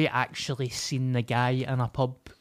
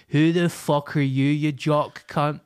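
mock posh Bearsden accent, louder, slow on the end; 2 semitones higher, 6 dB louder than usual. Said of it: "In a Bearsden accent".

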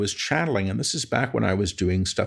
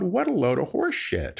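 high-pitched, indignant retort; exasperated, incredulous scoff; scornful dismissal; 9 semitones higher than usual. Retold or imitated. His scornful reaction to email.

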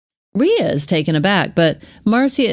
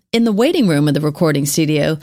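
normal talking voice

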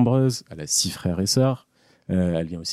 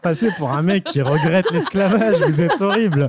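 higher and louder, laughing through the words; laughing protest; 8 semitones higher, 6 dB louder than usual. Real, not acted. Caught out by a host's joke, protesting through real laughter. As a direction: protest while cracking up.

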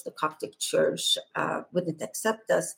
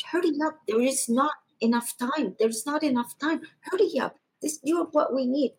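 higher, pressing urgency; urgent hurry; 7 semitones higher than usual. Retold or imitated. Pope rushing her back, high and urgent. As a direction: rush her out with mounting urgency.

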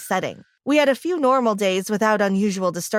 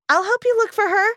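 put-on eager child's offer, much higher; a lonely girl thrilled to find a playmate; excitedly; 11 semitones higher than usual. A narrator's reading. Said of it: "Laura said excitedly".

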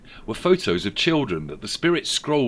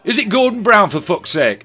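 high, loud, fast brash voice; brash Angela Rayner; 12 semitones higher, 11 dB louder than usual. A narrator's reading. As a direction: blurt it out brashly.

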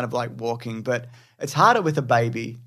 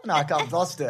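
ordinary delivery

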